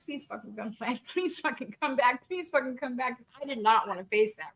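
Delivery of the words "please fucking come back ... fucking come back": soft, pleading whispered prayer; hushed desperate plea. Retold or imitated. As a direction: beg under the breath, repeating. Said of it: "And I prayed and prayed and prayed".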